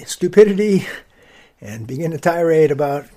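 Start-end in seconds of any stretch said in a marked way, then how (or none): 0.0-0.9 stupidity barked out loud and higher; stupidity snapped out loud in self-directed fury; loud outburst. Shown as a demonstration. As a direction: spit out the word stupidity as a sudden curse at yourself. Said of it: "I swear at my stupidity".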